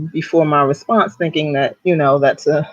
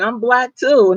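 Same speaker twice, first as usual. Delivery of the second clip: driver's reveal; 9 semitones higher, 4 dB louder, slower than usual. Retold or imitated. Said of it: "and then he said".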